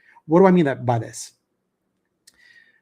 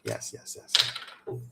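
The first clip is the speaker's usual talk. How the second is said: whispered satisfaction; 11 dB quieter, much slower than usual. Real, not acted. Savoring a vodka toast.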